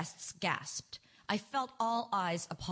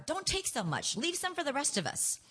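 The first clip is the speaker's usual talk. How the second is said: higher-pitched, mildly snappy scolding; snapping; 7 semitones higher than usual. A narrator's reading. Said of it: "I snapped at my oldest daughter".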